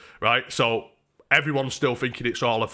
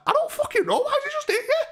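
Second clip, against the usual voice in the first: very high-pitched mock protest; imitated bafflement; faster than usual. Mate as baffled as the punched man, high mock-incredulous.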